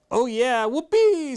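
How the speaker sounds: high mock cheer; sarcastic whoopee. A narrator's reading. Boy mocks the school play, high sarcastic cheer.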